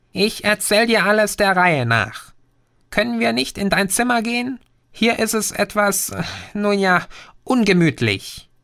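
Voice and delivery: put-on a cyber gnome calming the boy; tiny green creature. Green asks to leave bathroom. (kleines grünes Wesen, höher, beruhigend)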